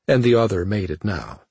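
ordinary delivery

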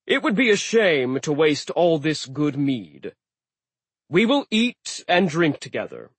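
loud, hearty giant host; a booming host inviting to eat; jovial sea giant. Acted. Aegir speaking.

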